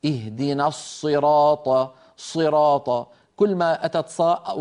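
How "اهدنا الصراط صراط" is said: slow الصراط with the lips pushed forward on the ص; lip-rounded ص. Shown as a demonstration. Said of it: "فلا يصح أن يقول القائل منا". (الصراط بضم الشفتين على الصاد ببطء)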